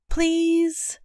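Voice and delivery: put-on high pleading little girl voice; begging child. A narrator's reading. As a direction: plead like a six-year-old. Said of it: "she begged her mom and then dad".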